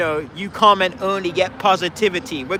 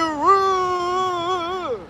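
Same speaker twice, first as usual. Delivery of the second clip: mock whimpering; 9 semitones higher, 3 dB quieter, much slower than usual. Mock whimper ending parody.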